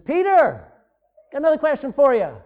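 Jesus calling to a distracted Peter. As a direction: call across to him, teasing.

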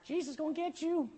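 high, soft parrot call. Acted. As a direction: squawk it quietly like a talking parrot. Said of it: "this parrot goes".

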